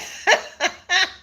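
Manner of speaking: high-pitched burst of laughter; loud high laugh. Real, not acted. Cracking up live at the viewers' jokes. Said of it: "got me laughing loud".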